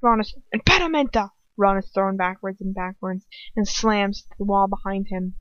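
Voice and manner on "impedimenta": spell cast. A narrator's reading. Lucius casts a spell.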